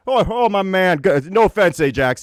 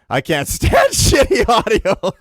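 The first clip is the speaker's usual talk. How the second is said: laughing; 2 semitones higher, slower than usual. Real, not acted. Laughs through complaint.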